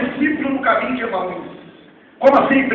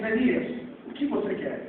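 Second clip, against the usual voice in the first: much softer, gentle asking; soft king's question; 15 dB quieter than usual. Acted. King softly asking Nehemiah what he wants. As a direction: ask him tenderly what he wants.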